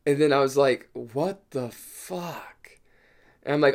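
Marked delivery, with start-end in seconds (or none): 0.9-2.8 slow, drawn-out whispered disbelief; stunned whisper. Retold or imitated. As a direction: whisper it in shock, word by word. Stunned reaction to drawing blood.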